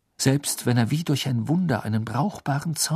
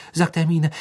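plain reading voice

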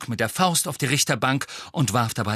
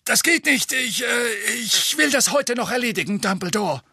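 higher, flustered minister; flustered official; 11 semitones higher than usual. A narrator's reading. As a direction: stammer like a cornered bureaucrat. Fudge flustered.

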